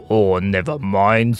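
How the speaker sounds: slow, carefree character with a put-on accent; Bonigo. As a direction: wave away the worry, drawn out. Bonigo dismisses the mess.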